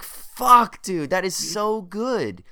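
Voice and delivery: loud, high burst, then slow and drawn out; amazed outburst. Retold or imitated. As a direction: blurt it out in awe, then savor it slowly. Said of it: "I'm like".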